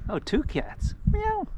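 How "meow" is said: high imitation meow; mock meow. Walker calling a cat with a high mock meow. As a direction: mew up high to lure the cat over.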